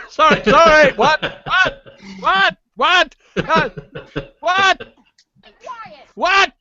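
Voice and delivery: high, loud, hammed-up alarm; mock panic. Podcaster joking a mob is coming, hammed-up panic. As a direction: yelp each what like you're under siege. Guest jokes he is under attack.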